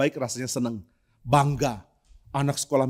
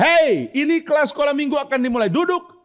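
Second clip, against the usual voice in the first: high, loud call for order; shouting at class; 10 semitones higher, 3 dB louder than usual. Retold or imitated. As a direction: yell over the noisy room to sit. Teacher shouting at noisy class.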